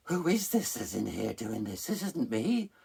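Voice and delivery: high, loud, hammed-up bewilderment; comic disbelief. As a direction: act shocked at yourself, pitched right up. Comedy routine of shocked self.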